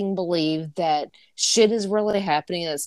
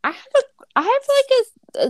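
mock formal; 4 dB louder than usual. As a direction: pose the question in a silly high voice. Mock serious question intro.

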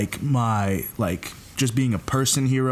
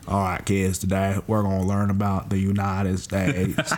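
lower-pitched imitation of a Texan history teacher; George W. Bush; 4 semitones lower than usual. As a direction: drop the voice into a folksy Texas accent. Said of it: "he sounds just like George W. Bush".

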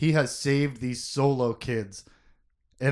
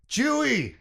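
cried out; 9 semitones higher than usual. A narrator's reading. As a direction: call out Chewie's name in desperation. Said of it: "he cried".

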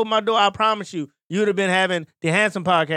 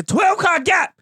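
furious at the traffic gap; outraged shout; 8 semitones higher, much slower than usual. Retold or imitated. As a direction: bellow it in disbelief.

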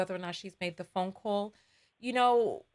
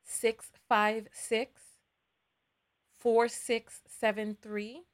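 slow dictation; much slower than usual. Shown as a demonstration. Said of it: "reading number slowly for dictation".

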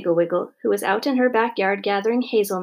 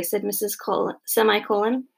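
usual speaking voice

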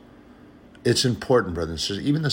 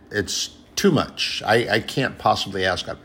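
usual speaking voice

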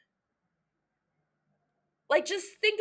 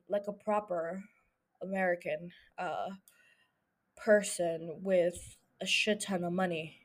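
lightly voiced, lower rich American accent; put-on rich American accent; 7 semitones lower, 8 dB quieter than usual. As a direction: drop into a faintly posh rich American voice. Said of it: "I'm still talking in accent".

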